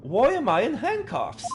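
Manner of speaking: outraged at the handcuffs; shocked hero. Acted. Player voices Ryunosuke demanding why he's cuffed, shocked.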